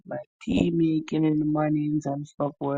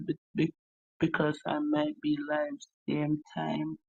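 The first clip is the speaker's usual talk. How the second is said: ordinary talk, nothing marked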